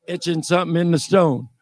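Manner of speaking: ordinary delivery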